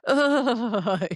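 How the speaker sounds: high, laughing shudder noise; mock shudder breaking into a laugh; shuddering ugh. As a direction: let out a high, trembling ugh. Imitates her shaking reaction to anger.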